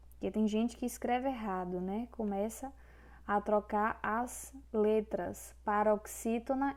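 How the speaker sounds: ordinary talk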